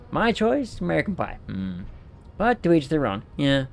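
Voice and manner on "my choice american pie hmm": ordinary delivery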